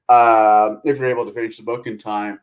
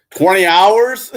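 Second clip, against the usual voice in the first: disbelief; 7 semitones higher, 6 dB louder, much slower than usual. Real, not acted. Shocked at book length.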